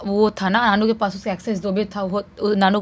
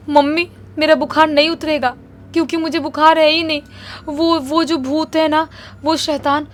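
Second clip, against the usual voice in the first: crying; 6 semitones higher, 3 dB louder than usual. Retold or imitated. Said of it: "बोलती है एक दिन रोते रोते मम्मी को".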